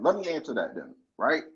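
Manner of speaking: usual speaking voice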